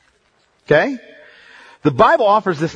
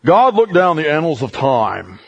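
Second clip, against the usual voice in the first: fake preacher voice. Shown as a demonstration. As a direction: ham up a pulpit preacher. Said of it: "That's my fake preacher voice".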